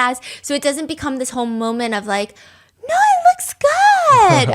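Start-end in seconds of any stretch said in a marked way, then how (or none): 2.9-4.5 high, slow, sugary fake reassurance; mock reassurance. Friend reassuring about a bad painting, high sweet fake voice. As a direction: coo it like a polite lie.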